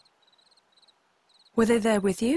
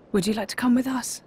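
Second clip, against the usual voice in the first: normal talking voice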